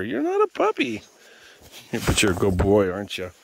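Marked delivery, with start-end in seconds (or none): 0.0-1.0 loud, very high voice for dogs; high dog-talk. Real, not acted. Owner calling pups and teasing Cooper, high and loud.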